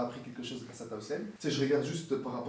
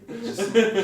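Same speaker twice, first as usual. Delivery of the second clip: words broken by a loud laugh; 9 semitones higher, 13 dB louder than usual. Real, not acted. Answering the host's tease while laughing.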